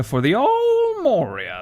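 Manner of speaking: high, slow squeaky repeat; silly high voice. Pretend. Gamer repeating the place name in a squeaky voice. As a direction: drag it out in a goofy falsetto.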